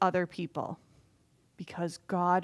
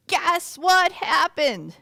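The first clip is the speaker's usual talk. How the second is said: tattling; 7 semitones higher, 7 dB louder, much slower than usual. Acted. People tattle to the king.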